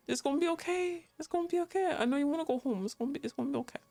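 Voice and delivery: ordinary talk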